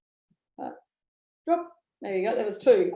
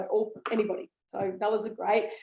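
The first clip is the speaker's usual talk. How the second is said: ordinary talk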